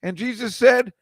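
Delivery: high, loud, slow preacher punchline; Joel Osteen's joke. Retold or imitated. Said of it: "opening joke is always hilarious".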